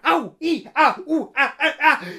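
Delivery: high, loud ows and ahs with a laugh; pained yelps. Acted. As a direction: yelp like you're caught in thorns. The woman's cries in the brambles.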